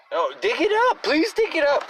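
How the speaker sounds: sarcastic high-pitched dare to dig up the horse; mock pleading. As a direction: whine it like a sarcastic plea. Mock begging of horse owners.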